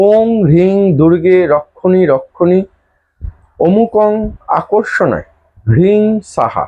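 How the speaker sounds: slow, drawn-out repeat of "ওম হ্রিং দুর্গে রক্ষণী"; mantra pronunciation demo. Shown as a demonstration. Teacher repeats the mantra for followers to learn, slow measured recitation. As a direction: recite "অমুকং আকর্ষণায় হ্রিং সাহা" slowly, word by word. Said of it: "কি বললাম?".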